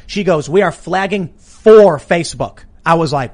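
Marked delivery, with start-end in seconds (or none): 0.5-2.6 "for" drawn out loud and slow; slow quote with a heavy, loud "for"; stress on "for". Retold or imitated. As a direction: punch and stretch the word "for". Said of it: "she emphasized the word for".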